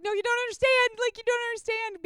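high, wailing mock complaint; pleading protest. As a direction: insist in a high voice that he's missing it. Protests to husband while crying.

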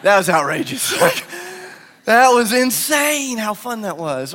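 giddy disciple. Retold or imitated. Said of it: "one guy in the boat going".

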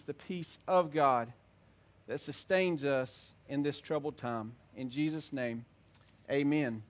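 low, hushed prayer voice. Acted. Pastor prays aloud for congregation.

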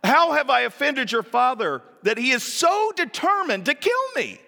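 desperate exclamation. Acted. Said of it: "David exclaimed".

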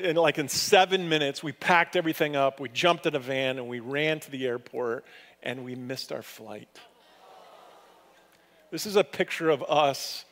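ordinary talk

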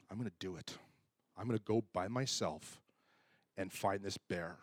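steel yourself quietly in the dark; hushed resolve. Retold or imitated. Pastor deciding to track the shot bear alone, hushed.

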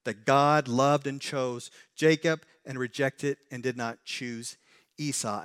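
usual speaking voice

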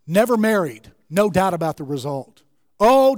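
normal talking voice

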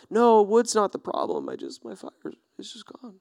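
fading to a near-silent, mopey murmur; mock forlorn. Sad camper telling a neighbour his fire is gone, mock forlorn. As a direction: play the pitiful camper who lost his fire.

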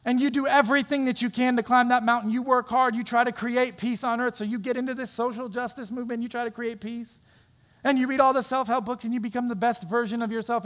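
loud, high, driving sermon voice; preaching. Acted. Pastor preaching hard to the congregation, full force. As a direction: preach it to the whole room with force.

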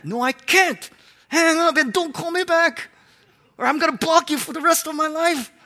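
high, exasperated outburst; acted annoyance. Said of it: "Would you be really annoyed by that person?".

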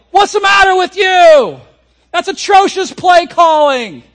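high, loud angry shout; disgusted players. Retold or imitated. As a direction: yell at the bad play calling.

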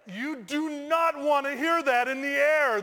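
loud, high, slow comic dismay; exasperated emphasis. Retold or imitated. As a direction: spell out the dismay slowly and loudly. Exclaims over instructor's late news.

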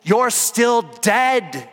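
rising sermon shout; full-force preaching. Acted. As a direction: preach it out to the whole room.

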